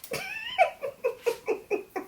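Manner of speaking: very high, squeaky soft laugh; real cracking up in a long, high, quiet giggle; helpless laughter. Real, not acted. Recapper cracked up by the begging scene, laughing.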